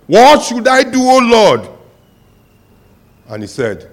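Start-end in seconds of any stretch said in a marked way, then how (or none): 0.1-1.7 pleading shout. Acted. Man hanging begs God.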